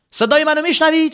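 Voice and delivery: high loud call into a cave. Acted. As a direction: shout the question into the dark. Calling out in cave.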